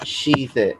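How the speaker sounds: शी, hushed and soft; Chinese x as shi. Shown as a demonstration. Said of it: "एक्स का sound आता है".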